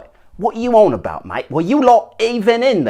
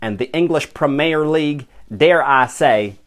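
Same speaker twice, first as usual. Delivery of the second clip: ordinary talk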